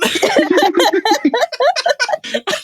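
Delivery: high burst of laughter; real laughter; big laugh. Real, not acted. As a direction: crack up at the joke. Laughing at Dracula joke.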